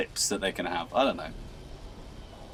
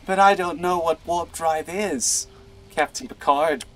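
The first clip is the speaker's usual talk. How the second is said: whiny Skywalker; 7 semitones higher than usual. Pretend. Acted Skywalker reply.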